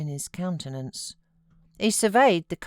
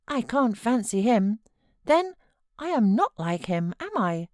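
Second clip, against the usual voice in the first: put-on musing boy; 6 semitones higher, 4 dB louder than usual. A narrator's reading. Linton's voice.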